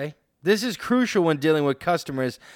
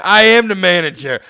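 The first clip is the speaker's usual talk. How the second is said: smug retort; 4 semitones higher, 9 dB louder than usual. Acted. Acted clerk retorts.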